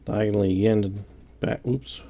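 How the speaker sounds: usual speaking voice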